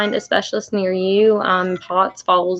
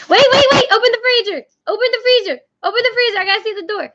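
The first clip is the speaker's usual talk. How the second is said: much higher voice, loud at first; urgent high-pitched calling; 14 semitones higher, 4 dB louder, faster than usual. Real, not acted. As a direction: call out high to stop someone, easing off in volume. Calling to have freezer left open.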